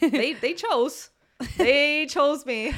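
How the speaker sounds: very slow, dramatic mock-fateful echo; mock ominous. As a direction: drag it out like a movie prophecy. Echoes friend dramatically.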